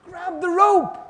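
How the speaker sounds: high, loud call down the well; shout. Retold or imitated. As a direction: shout it down to someone below. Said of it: "the person above shouts".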